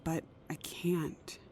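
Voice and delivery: slow, broken whisper; sad whisper. Acted. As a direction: whisper it slowly, giving up. Wants to tell the world, can't.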